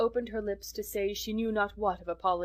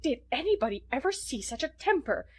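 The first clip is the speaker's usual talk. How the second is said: horrified; 6 semitones higher than usual. A narrator's reading. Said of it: "exclaimed the horrified Missus Rachel".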